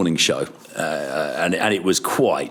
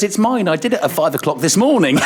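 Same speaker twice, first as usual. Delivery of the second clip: triumphant reveal; 8 semitones higher, faster than usual. Retold or imitated. Katie's retold reveal.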